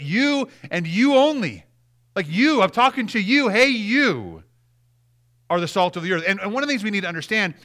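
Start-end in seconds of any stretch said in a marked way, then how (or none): 0.0-4.4 every "you" punched, high in pitch; emphatic YOU. Shown as a demonstration. Preacher telling the congregation it means them alone, "you" stressed hard. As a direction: drive home each "you" as if singling out the listener. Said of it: "it gives this emphasis".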